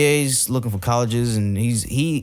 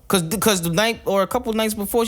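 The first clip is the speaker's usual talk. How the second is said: ordinary talk, nothing marked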